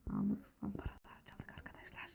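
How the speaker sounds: secret whisper to viewers. Real, not acted. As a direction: whisper it so the villager won't hear. Sneaking off with stolen money. (fısıltıyla)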